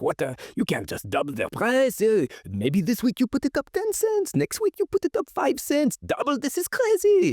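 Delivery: rising outrage, hammed up; complaining neighbor. Neighbor complaining to the kids about the doubled price, mock outrage. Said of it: "one neighbor complained. He's like".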